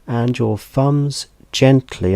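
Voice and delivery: gently stretched out slow and lifted high; stress on gently. Shown as a demonstration. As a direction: lean hard on the word gently, drawing it out. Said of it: "and I emphasize the word gently".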